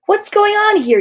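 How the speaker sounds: high, loud astonished shout. Acted. Charlie Brown returning to find the tree decorated, astonished shout. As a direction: call it out in disbelief. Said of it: "he shouted".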